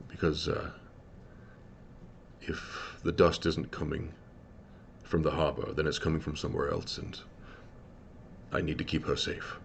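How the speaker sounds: a lightly voiced big man asking a favour quietly; large man's voice. Large man asks old friend Scosset for help, low and soft.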